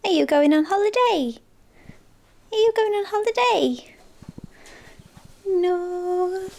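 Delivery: singsong pet voice. Real, not acted. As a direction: coo sweetly at your pet, pitch way up. Cooing to cats before leaving.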